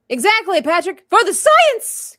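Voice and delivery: high, loud rallying cry; mock-heroic cry. Host cheers on Patrick for the science, mock-heroic cry. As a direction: belt out a playful battle cry, drawing it out.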